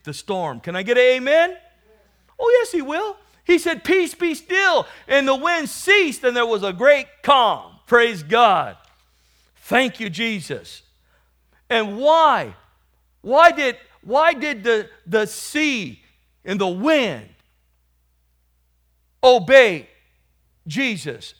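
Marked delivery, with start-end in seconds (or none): 0.6-3.8 loud, high call for response; rousing preaching. Acted. Preacher asking the church for an amen, rousing preaching. 3.8-4.9 high, loud, slow rebuke; commanding shout. Retold or imitated. Jesus rebuking the wind, loud commanding cry. 5.1-21.3 loud, raised, emphatic preaching. Acted. Preacher asking why sea and wind obey, forceful preaching.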